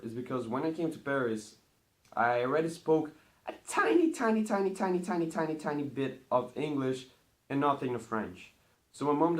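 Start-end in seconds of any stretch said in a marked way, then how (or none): 2.1-7.1 each "tiny" stretched, the first ones pitched higher; milk "tiny, tiny, tiny" to show how little English; stressing how tiny. Real, not acted. Vlogger telling viewers how little English he spoke, emphatic.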